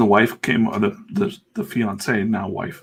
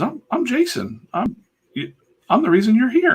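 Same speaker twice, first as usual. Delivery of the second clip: proud host; 4 dB louder than usual. Retold or imitated. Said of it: "He goes".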